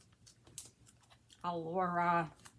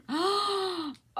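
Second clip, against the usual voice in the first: big, loud gasp of amazement; long, astonished intake of breath; delighted gasp; 11 semitones higher, 10 dB louder than usual. Real, not acted. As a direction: gasp sharply at a lovely find. Startled by a lovely find.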